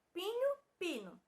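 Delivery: "Pinho" with a palatal nh, then "pino" with plain n; contrasted pronunciations. Shown as a demonstration. Teacher compares words for pupils, contrasted "Pinho", "pino". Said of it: "Que mudança a letra H causou na pronúncia".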